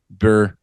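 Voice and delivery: Burr said as one short syllable, like fur. Shown as a demonstration. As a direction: clip it to one beat: Burr. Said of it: "one would be burr".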